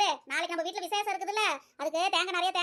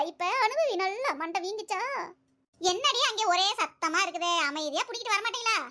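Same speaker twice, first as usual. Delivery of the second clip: ordinary talk, nothing marked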